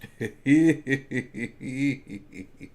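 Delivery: long, wheezy run of laughter; helpless real laugh in short bursts. Real, not acted. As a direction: laugh hard at the draft pick. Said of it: "I'm laughing at this Kyle Trask pick".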